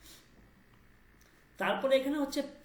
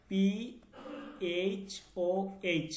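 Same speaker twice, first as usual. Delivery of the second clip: slow spelling out; 3 semitones lower, slower than usual. Shown as a demonstration. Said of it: "আরো যদি সহজে দেই".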